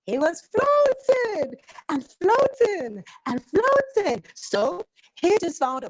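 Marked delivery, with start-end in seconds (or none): 0.1-4.2 high, slow floating and floating; storyteller drawing out the drift; slow dreamy floating. A narrator's reading. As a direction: stretch it out like drifting. Drawn-out floating description.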